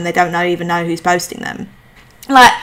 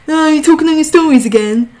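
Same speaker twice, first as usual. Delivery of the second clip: high-pitched mocking imitation of a little sister; teasing little sister; 8 semitones higher than usual. Annoying little sister taking the piss, high mocking voice. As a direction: needle them in a higher, put-on voice.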